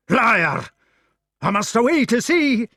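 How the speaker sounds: high, loud furious cry; shout. Acted. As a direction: hurl it as you rush out. Gawain's outburst.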